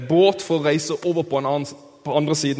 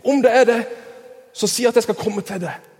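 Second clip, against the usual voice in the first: acted call, higher and louder; Peter roper til Jesus; 6 semitones higher, 4 dB louder than usual. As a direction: call out to someone across the water. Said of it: "Og Peter sier". (ropende, spilt tilrop over vannet)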